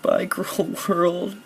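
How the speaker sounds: high, drawn-out theatrical goodbye; hammed-up dying farewell, slow and high; mock farewell. Gamer bids the cruel world goodbye as he starves, mock-tragic.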